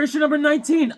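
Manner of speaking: ordinary talk, nothing marked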